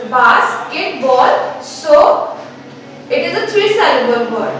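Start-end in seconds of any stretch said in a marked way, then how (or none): 0.1-1.6 basketball very slow, bas-ket-ball apart; split into syllables. Shown as a demonstration. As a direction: break basketball into its three beats. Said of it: "it can be divided into three pronunciations".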